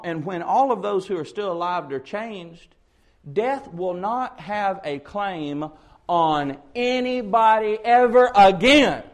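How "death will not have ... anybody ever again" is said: slow, high, building to a shout; emphatic proclamation swelling at the end; climactic preaching. Real, not acted. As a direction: declare it louder word by word.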